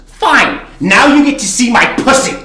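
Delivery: mocking high girl voice; defiant shriek. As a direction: throw the threat at him. Girl defiantly threatens bartender.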